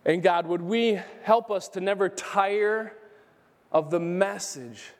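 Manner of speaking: hushed opening prayer. Acted.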